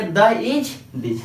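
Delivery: "द इज दिज" drawn out slowly, part by part; slow repeat. Shown as a demonstration. Teacher repeating "द इज दिज" slowly for students. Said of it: "एक बार फिर से मैं बोल रहा हूँ".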